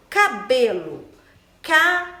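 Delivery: "cabelo" broken into ca, be, lo; loud, separated syllables of "cabelo". Shown as a demonstration. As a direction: sound out "cabelo" piece by piece. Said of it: "sílaba mais forte da palavra".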